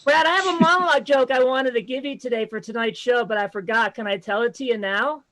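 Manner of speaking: ordinary talk, nothing marked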